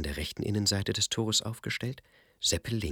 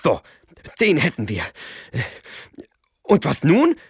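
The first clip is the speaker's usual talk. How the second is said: aufgeregt; 9 semitones higher, 11 dB louder than usual. Acted. Seppel nach dem Fang.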